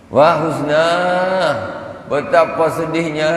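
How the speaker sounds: mock-mournful cry of sorrow; lamenting sigh. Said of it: "kata Imam Sufyan Al-Thawri".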